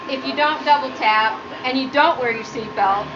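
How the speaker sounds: ordinary talk, nothing marked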